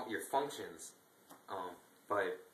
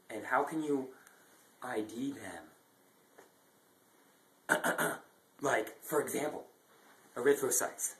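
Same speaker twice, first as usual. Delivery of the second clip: ordinary talk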